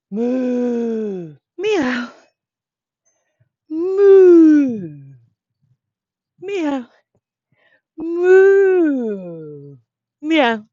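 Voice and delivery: loud, high alternating moo and meow; animal noises. Acted. Teacher leading kids in animal sounds. Story animals, cow and cat.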